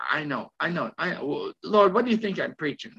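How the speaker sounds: ordinary talk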